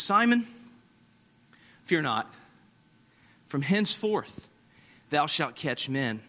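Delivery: hushed, tender comfort; gentle Jesus voice. Acted. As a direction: speak it tenderly to a frightened man.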